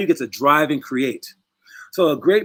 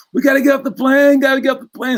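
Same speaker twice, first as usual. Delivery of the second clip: panicked high shout, much higher and louder; freaking out; 10 semitones higher, 4 dB louder than usual. Retold or imitated. Man from the dream begging everyone off the plane, panicked shout. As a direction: yell it like the plane is about to go down. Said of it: "starts freaking out".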